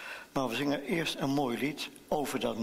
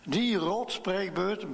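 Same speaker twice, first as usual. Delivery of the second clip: drawn-out, higher frustrated outburst; mild hammed-up childish outburst; bursting out; 6 semitones higher, slower than usual. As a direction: let the frustration burst out slowly. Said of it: "barst Tineke los".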